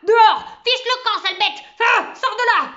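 high, very loud panicked yells; frenzied shouting. A narrator's reading. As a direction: shriek at an intruder to get off you. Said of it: "pris d'une véritable frénésie". (hurlements frénétiques et paniqués)

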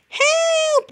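very high, loud squawk for help; cry for help. Acted. Trapped birds shriek for help at the fox. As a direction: scream it like a panicked bird. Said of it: "they all cried".

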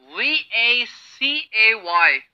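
slow letter-by-letter spelling; slow spelled letters. Shown as a demonstration. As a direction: spell V-A-C-A-Y slowly, each letter apart. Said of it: "हमारा first word है".

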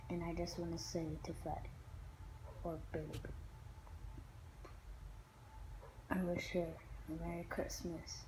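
soft, hushed tribute to a lost pet; subdued farewell. Real, not acted. Farewell wish to the dead dog.

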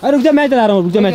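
loud, high call falling at the end; urgent interruption. Real, not acted. Friend stopping the driver to take the wheel, urgent shout. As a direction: cut in loudly to take over. Taking over stuck car's driving.

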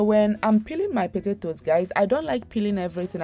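ordinary delivery

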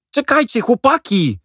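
high, loud call after them. A narrator's reading. Jack calling after the leaving boys, high and loud. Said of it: "zawołał".

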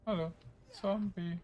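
high, sing-song hello to the zombie; teasing high hello; mock greeting. Real, not acted. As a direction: taunt it with a cheery hello.

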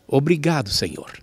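ordinary delivery

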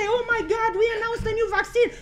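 high, fast mock-thrilled; mocking excited announcement; mock excitement. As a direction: parody their own big announcement. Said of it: "We say".

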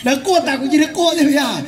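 ordinary talk

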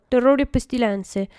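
plain reading voice

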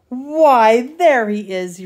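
hammed-up daddy bear roar; playful roar. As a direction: boom it out like a big bear who found him. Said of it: "roars the big bear".

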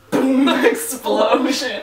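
big, loud, laughing boom; imitated explosion. Co-host picturing the car blowing up, loud playful boom. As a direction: blow it up vocally, loud and high. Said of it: "picturing you going like".

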